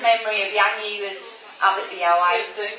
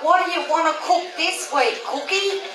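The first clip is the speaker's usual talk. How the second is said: high, louder laughing call; high laughing shout to the cook; retold yell; 10 semitones higher, 3 dB louder than usual. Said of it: "he'd yell out".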